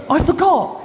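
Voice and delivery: high, far squeaky excuse; high, whiny mock defendant excuse; sheepish defendant. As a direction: squeak it like a caught-out kid. Defendant answers the judge.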